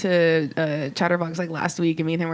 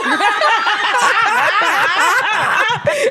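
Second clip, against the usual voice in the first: real helpless laughter at the memory; loud high laughter; 9 dB louder than usual. Real, not acted. Teller cracking up.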